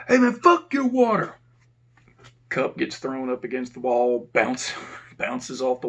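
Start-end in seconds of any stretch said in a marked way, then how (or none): 0.1-1.3 high, loud, scornful yell; inmate yelling. Retold or imitated. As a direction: throw it back like an angry inmate. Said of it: "Inmate yells out".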